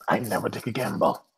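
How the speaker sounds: quiet, guarded impression of a schemer; Cyrus. Co-host mimicking how he always talks, quiet sly impression. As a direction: imitate him softly, barely moving. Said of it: "he's always talking like this".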